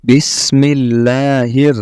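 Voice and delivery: slow, drawn-out recitation; Quran recitation. Shown as a demonstration. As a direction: recite with long held syllables for learners. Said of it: "আয়াত তিলাওয়াত করার চেষ্টা". (ধীরে টেনে তিলাওয়াত)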